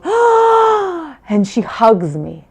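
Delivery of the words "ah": a long, much higher, louder delighted "Ah"; delighted high gasp. Retold or imitated. Mother imitates daughter's delighted gasp on seeing her. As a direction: gasp with joy like a little girl. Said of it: "she goes".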